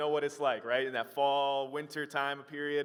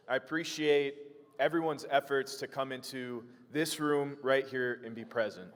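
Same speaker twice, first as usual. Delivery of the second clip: ordinary talk, nothing marked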